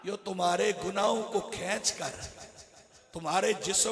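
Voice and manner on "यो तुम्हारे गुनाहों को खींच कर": ordinary talk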